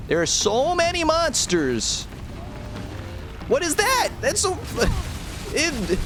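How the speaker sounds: squeaky high freak-out at the monsters; high panicky voice cracking into a laugh; voice goes way up. Real, not acted. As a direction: pitch shoots way up in alarm, slow at first. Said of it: "it makes my voice go up three octaves".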